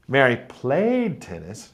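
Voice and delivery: played said strong, Mary and tennis light; stress on played. Shown as a demonstration. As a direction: lean on the word played. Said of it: "So I'm stressing that word".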